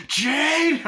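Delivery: high, loud call of a name. Retold or imitated. Father calling his daughter downstairs, loud high call. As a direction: shout her name up the stairs.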